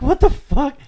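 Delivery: high, loud retold disbelief; shocked yell. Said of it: "I'm like".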